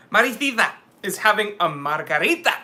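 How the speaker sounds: put-on playful Spanish accent with lisped sounds. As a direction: lay on a Spanish accent, rising at the end. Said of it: "I can do that accent".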